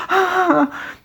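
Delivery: very high, loud gasp; panicked shriek. Retold or imitated. Mum hyperventilating in panic, a very high shriek. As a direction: shriek in terror.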